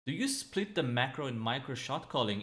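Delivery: normal talking voice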